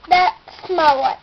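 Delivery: ordinary delivery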